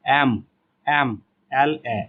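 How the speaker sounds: एम एल ए said slowly, letter by letter; letter sounds shown. Shown as a demonstration. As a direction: sound out एम एल ए, each letter apart, starting on the vowel "e". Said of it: "तो कौन सा sound निकल रहा है".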